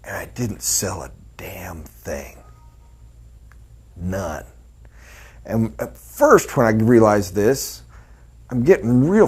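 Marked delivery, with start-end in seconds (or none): 0.0-4.4 breathy whisper, almost no voice. Real, not acted. Admitting to the room he sold nothing, whispered. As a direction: let it fall to a hushed whisper. Confessing failure, drops voice.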